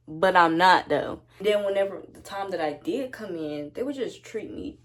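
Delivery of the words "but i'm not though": louder, slow, deliberate no; loud slow defiant reply; firm loud refusal. Retold or imitated. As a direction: plant your feet and refuse.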